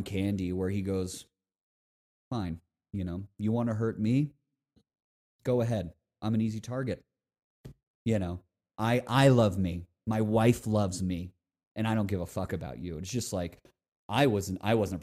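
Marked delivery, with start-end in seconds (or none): 2.3-13.0 low, subdued wounded dignity; genuinely hurt. Retold or imitated. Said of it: "He's genuinely hurt by what happens".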